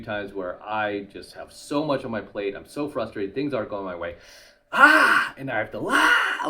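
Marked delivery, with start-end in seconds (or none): 4.7-5.3 loud frustrated "ah" let out; frustrated yell. Retold or imitated. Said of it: "let it out". 5.9-6.5 loud frustrated "ah" let out; frustrated yell. Retold or imitated. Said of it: "let it out".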